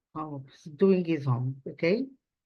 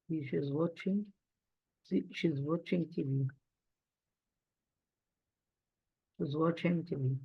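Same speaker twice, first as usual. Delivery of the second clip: quiet dictation; 5 semitones lower, 10 dB quieter than usual. Real, not acted. Teacher repeats while writing.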